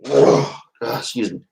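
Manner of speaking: jittery and wired; wired on coffee; too much caffeine. Real, not acted. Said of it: "Still wired from the freaking coffee".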